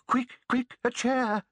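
put-on high, hurried old woman. A narrator's reading. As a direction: fuss like a flustered grandmother. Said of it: "says the old woman".

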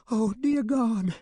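high, slow frantic cry; frantic woman. Acted. Young mother runs out of the store, frantic. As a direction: panic for her little boy. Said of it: "A frantic young woman".

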